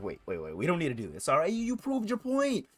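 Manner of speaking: a hint of trembling fear; shaky plea. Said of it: "he's shaking and shit".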